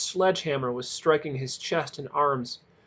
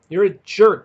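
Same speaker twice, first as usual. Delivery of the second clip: sobbing; 4 semitones higher, 10 dB louder than usual. A narrator's reading. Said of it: "Peter sobbed".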